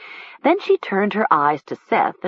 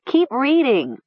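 pleading; 7 semitones higher than usual. Acted. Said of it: "pleaded Nessa".

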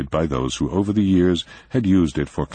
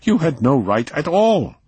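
much higher, louder protest; a mild man finally angry; indignant protest; raised in anger; 12 semitones higher, 4 dB louder than usual. A narrator's reading. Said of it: "his voice raised in uncharacteristic anger".

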